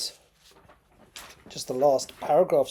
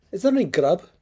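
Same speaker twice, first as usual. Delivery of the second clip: a hearty Scottish voice, pitched up; Mackenzie's Scottish brogue, raised and eager; Scottish accent; 6 semitones higher, 7 dB louder than usual. Acted. Recovering patient asks his friends for food, in a Scottish accent.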